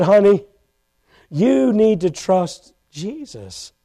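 retold soft, low, slow urging; gentle counsel. Wife urging her weeping husband to trust Jesus, gently. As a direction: softly imitate a wife's tender advice.

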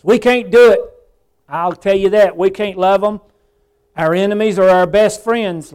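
impassioned pulpit delivery; full-force preaching. Real, not acted. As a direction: preach it high and loud, building in force.

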